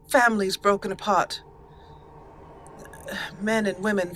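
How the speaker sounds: ordinary delivery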